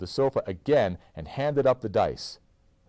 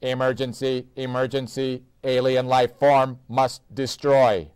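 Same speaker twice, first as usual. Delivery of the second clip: mechanical, higher robot voice; 5 semitones higher, 6 dB louder than usual. A narrator's reading. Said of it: "he spoke in an odd mechanical voice".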